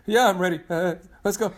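high, loud jittery reply; nervous stutter. Retold or imitated. Dalton freaking out, answering nervously. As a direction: sound rattled but game. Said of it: "stuttering as he's like".

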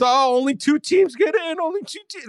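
put-on high protest, fading at the end; mocking the traditionalists. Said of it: "You guys want these old".

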